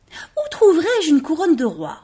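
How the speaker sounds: high, eager little rabbit voice; a small rabbit asking hopefully. Acted.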